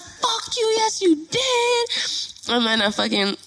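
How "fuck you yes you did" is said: very high, drawn-out angry comeback; retold furious retort. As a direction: re-enact screaming back, without full volume.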